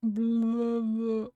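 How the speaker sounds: drawn-out wordless monster groan; long, blubbering mock groan; unintelligible groan. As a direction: groan out nonsense as a creature. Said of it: "Unintelligible groans".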